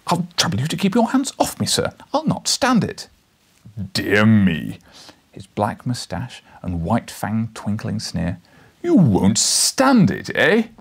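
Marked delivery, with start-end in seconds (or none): 0.1-3.1 put-on higher, indignant young reporter; young man defying. Reporter warning Challenger off, high and indignant. 3.9-4.8 low, loud mocking "Dear me"; sneering professor. Challenger mocking the reporter, low and loud. 8.8-10.7 drawn-out loud mocking professor; slow booming taunt. Challenger taunting the reporter, slow and booming.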